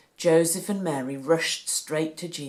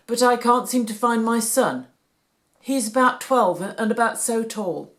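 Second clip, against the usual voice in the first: worried father; 4 semitones higher, 7 dB louder than usual. A narrator's reading. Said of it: "said Joseph".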